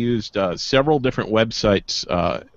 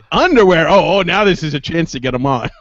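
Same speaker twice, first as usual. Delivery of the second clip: retold excitement; 6 semitones higher, 5 dB louder than usual. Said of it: "I said".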